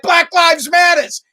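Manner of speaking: high, slow mock shout; hammed-up outburst. Wife interrupts husband in bed, mock outburst. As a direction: draw it out as an imitated cry. Wife's ill-timed shout during sex.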